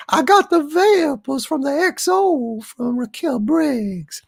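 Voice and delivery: flutter like a Southern belle with the vapors; very high, swooning Southern lady. Acted. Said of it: "Southern Belle Doomcock".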